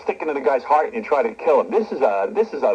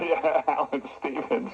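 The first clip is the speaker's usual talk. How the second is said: soft laugh through the words; 6 dB quieter, slower than usual. Real, not acted. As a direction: chuckle along as you agree, drawn out. Host laughing over caller's comment.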